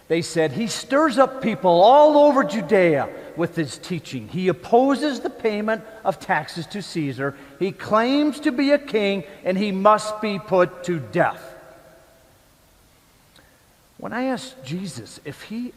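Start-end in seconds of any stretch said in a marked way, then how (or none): 0.5-11.5 higher and louder, indignant accusation; angry outburst. Retold or imitated. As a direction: hurl the charges like an outraged mob. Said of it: "they threw a fit".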